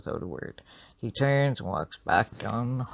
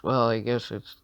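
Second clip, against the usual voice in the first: plain reading voice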